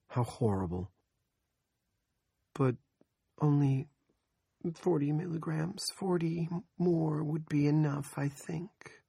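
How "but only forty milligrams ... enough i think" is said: high, slow whispered bargaining; hesitant whisper. Acted. Andrea asking for more pills, high whisper. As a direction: barely dare to ask.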